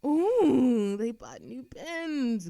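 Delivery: a loud "Ooh", then slow delight; thrilled over new pens; loud, gleeful coo of delight; delighted find. Acted. Finds new pens at desk.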